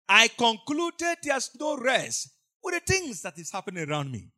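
normal talking voice

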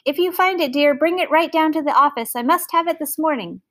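hurried high-pitched call to a wife. A narrator's reading. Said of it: "He called to Missus Burbank".

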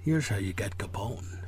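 whispered low Connery voice. Acted. Malone whispers the secret to Ness: low whisper. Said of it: "Sean Connery accent".

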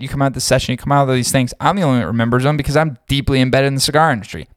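usual speaking voice

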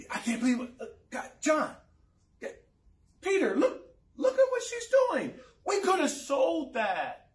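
high, incredulous acted protest; sputter, then build to high-pitched indignation; outraged disciple. Disciple complaining to Peter and Jesus about waste, outraged.

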